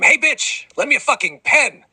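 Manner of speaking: very high rude yell; shrieking kid; screamed demand. Acted. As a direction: screech it across the classroom. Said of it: "stand up and scream".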